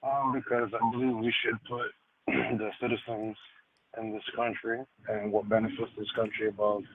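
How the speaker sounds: distant and faint, far from the mic; from another room. Real, not acted. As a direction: speak from across the room. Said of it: "You sound like you're in another room".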